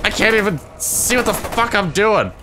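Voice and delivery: high, strained-up frustrated rant; angry rant. Real, not acted. Player blinded by shaking screen, ranting angrily. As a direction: rant high, dragging out the final insult.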